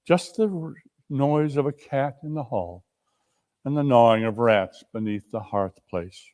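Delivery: ordinary delivery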